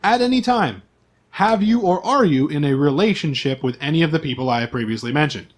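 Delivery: usual speaking voice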